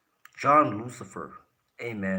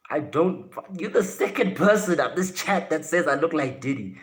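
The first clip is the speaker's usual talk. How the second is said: loud, high-pitched exasperation; real, worked-up objection; indignant protest; 7 semitones higher, 8 dB louder than usual. Real, not acted. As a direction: push back loudly at the teasing. Protests at being compared to [name].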